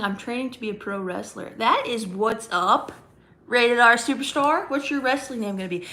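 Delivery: ordinary talk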